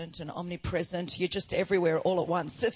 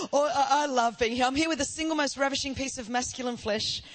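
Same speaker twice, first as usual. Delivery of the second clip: ordinary delivery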